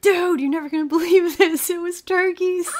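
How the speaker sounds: gleeful announcement to a friend; excited. Retold or imitated. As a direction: burst out with the news. Telling her friend the news.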